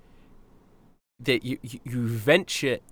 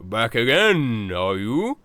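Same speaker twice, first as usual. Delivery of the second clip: slow, louder noble mushroom; Baron Foong voice; 5 dB louder, much slower than usual. Acted. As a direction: greet them with lordly ease. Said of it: "he raises a hand and he goes".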